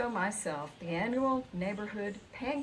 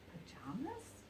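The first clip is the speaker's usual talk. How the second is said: whispered, rising question; puzzled whisper; 5 semitones higher, 10 dB quieter than usual. A narrator's reading. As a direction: breathe it out, puzzled.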